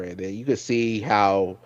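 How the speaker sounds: usual speaking voice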